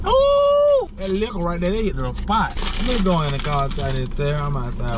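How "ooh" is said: a loud high-pitched whoop; a shrill ooh as the drink hits. Acted. Vlogger showing off the sip for viewers, loud high whoop.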